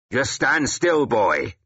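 sharp, high-pitched snarl; irritated command, starting high and dropping; snarled order. Acted. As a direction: snarl it at an unruly boy. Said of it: "Wulfhere snarled".

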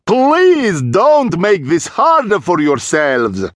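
slow, loud, high theatrical vampire; grand, ringing, drawn-out plea; booming voice. A narrator's reading. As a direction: boom out a measured warning. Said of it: "The same booming voice said".

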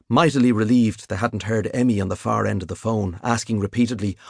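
ordinary delivery

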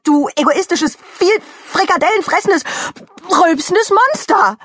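high, furious tirade; raging rant. Acted. Mickey Kuchen berating the meatball-eating monster, raging. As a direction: work yourself into a rage. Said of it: "hatte sich in Rage geredet".

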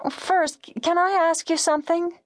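high, tentative woman caller; timid caller pitched high; hesitant radio caller. A narrator's reading. Caller stumbles into her question.

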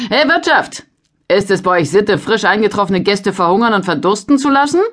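laut und viel höher durch den Raum gerufen; lauter Ruf. A narrator's reading. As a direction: fordernd nach dem Wirt rufen. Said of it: "Auf diesen Ruf hin". (Händler ruft fordernd nach dem Wirt)

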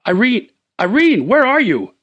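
calling out. Acted. Husband calls for lost wife in darkness, calling out. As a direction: call her name searching for her.

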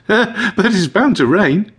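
high, slow cheery voice after a little laugh; chuckle, then draw it out jokingly; cheerful high-pitched quip; chuckling. A narrator's reading. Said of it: "she says and chuckles".

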